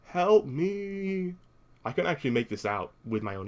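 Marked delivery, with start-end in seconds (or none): 0.1-1.4 drawn-out, higher-pitched mock call for help; imitated distant cry. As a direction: imitate a far-off cry, stretching the words. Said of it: "to faintly hear him cry".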